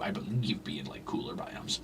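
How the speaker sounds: half-whispered aside, trailing off. Real, not acted. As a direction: drop to a breathy whisper. Trailing aside while building.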